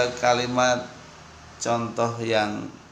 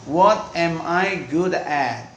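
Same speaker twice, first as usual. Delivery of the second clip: 1 slow model reading; 5 semitones higher, 4 dB louder, much slower than usual. Shown as a demonstration. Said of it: "saya tuh bagusnya tentang apa sih".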